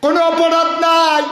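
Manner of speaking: high, loud sustained chant with drawn line endings; full-force preaching. Real, not acted. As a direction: proclaim the injustice to the crowd at full cry.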